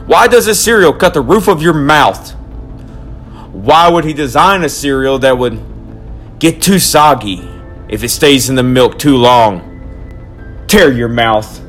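loud, worked-up tirade; ranting. Acted. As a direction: rant like a crazed conspiracy man. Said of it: "the Lucky Charms rant".

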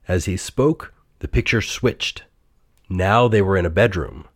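ordinary delivery, nothing marked

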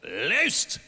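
mock Benny Hinn healer's pronouncement; faith healer. Pastor jokingly plays healer over a woman, mock proclamation. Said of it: "just pretend I'm Benny Hinn".

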